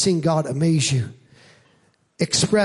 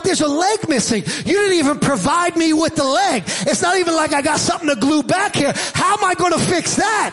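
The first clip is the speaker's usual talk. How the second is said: high-pitched mock outrage of a dad; exasperated protest; 8 semitones higher than usual. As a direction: protest in hammed-up disbelief, pitch climbing. Acts out dad's protest over doll.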